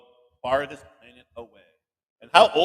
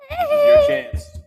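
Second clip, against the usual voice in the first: hyped prompt; 9 dB louder, much slower than usual. Acted. Host hypes the kids.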